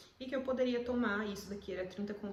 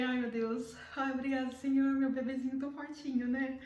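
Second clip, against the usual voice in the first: relieved exclamation; 4 semitones higher, 5 dB louder than usual. Retold or imitated. Relief on hearing baby's heartbeat.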